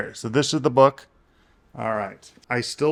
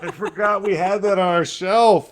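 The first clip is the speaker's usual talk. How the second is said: higher and louder, laughing through it; excited realization, higher and louder; excited recognition; 6 semitones higher, 7 dB louder than usual. Real, not acted. Husband realizes he has read the book, excited and laughing.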